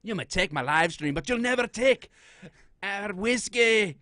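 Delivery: loud, high Scottish battle cry; hammed-up Scottish rallying speech; mock Scottish accent. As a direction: declaim defiantly in a broad fake Scottish brogue. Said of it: "Scottish accent".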